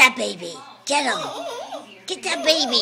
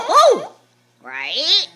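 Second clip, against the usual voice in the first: ordinary delivery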